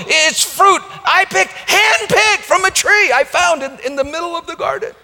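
shouted very high, proud pleading boast; mock boasting plea. Preacher mocking sinners offering stolen fruit to God, shouting.

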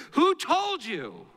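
high, slow, drawn-out question; wondering challenge. Retold or imitated. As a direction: ask it slowly, pitched right up. Acted question to the ashamed children.

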